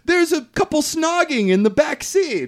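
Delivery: high, stretched-out teasing call; mock tattling voice. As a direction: imitate a girl telling on a couple, slow and high. Spice Girl teasing the cinema audience.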